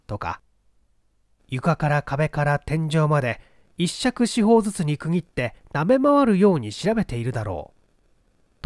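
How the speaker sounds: usual speaking voice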